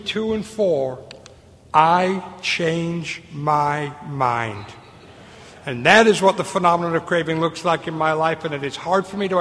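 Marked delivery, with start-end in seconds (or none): 1.7-4.6 words drawn out very slowly; very slow punchline. Real, not acted. Speaker delivering his punchline, drawn out slowly. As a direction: let it land, dragging each word.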